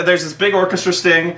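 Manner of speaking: ordinary delivery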